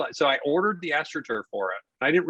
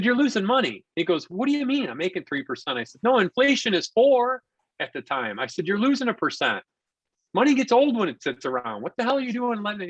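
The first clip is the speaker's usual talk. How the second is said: higher, emphatic push about losing money; retold excited lecture; 8 semitones higher than usual. Construction guy schooling the rich homeowner, higher and emphatic. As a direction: lay it out like it's obvious.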